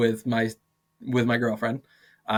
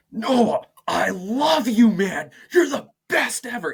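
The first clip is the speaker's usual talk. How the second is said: loud high yell full of praise words; yelled compliments; 12 semitones higher, 6 dB louder than usual. Retold or imitated. Teacher yelling praise at a student to show tone, mock angry shout. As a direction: shout the kind words like a scolding. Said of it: "he starts, like, yelling at me".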